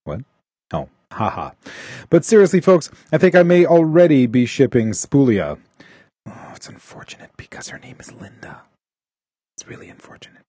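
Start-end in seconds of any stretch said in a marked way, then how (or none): 6.3-10.4 hushed, breathy whisper of regret; a soft whispered aside to the co-hosts. Real, not acted. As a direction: lean in and whisper it like a secret. Whispered aside about the name.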